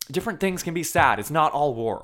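high, loud mock indignant retort; imitating Lady Bird. Lady Bird rebutting Kyle's war remark, mock indignant. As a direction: snap it back defensively. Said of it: "And she says".

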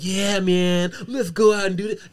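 mock stoner dude. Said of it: "you're always the high dude".

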